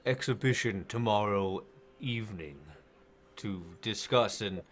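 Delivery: deep, deliberate voice of a king's adviser; Parthon. Acted. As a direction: speak low and measured, pausing between phrases. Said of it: "it's the voice of Parthon".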